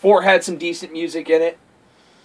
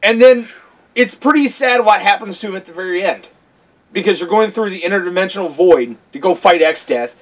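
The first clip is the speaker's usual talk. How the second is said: ordinary talk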